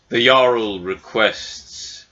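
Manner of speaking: slow, measured formal summons; grand messenger giving a slow proclamation; cloud giant thane. Acted. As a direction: deliver it ponderously, with stately pauses. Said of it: "you hear the voice of the Cloud Giant".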